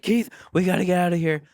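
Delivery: high, anxious mock plea; relieved urgency. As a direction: act out her relief and urge him to leave. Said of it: "like relieved, kinda like".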